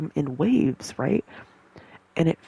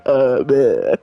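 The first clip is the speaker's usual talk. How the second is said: mock seizure noise; 3 semitones lower, 8 dB louder than usual. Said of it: "and then I'd be like".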